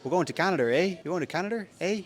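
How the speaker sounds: attempted Canadian accent sliding into Irish; bad Canadian accent. Traveller retrying the accent for a friend, Irish-sounding mock Canadian. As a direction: botch a playful Canadian accent, Irish-tinged. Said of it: "that does sound Irish".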